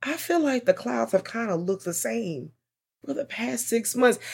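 high, soft, musing movie character. Retold or imitated. Host voicing a puzzled movie character, high and breathy. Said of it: "one of the characters going".

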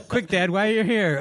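a hint of a testing daughter; daughter quizzing dad. Acting out daughter quizzing forgetful dad.

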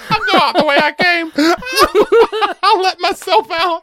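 high squeaky laughing snake. Retold or imitated. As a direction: laugh it out up high, a bit fast.

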